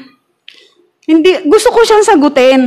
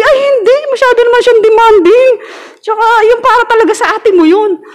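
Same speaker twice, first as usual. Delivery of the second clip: voicing the pastor; 10 semitones higher than usual. Pastor voiced dismissing demand.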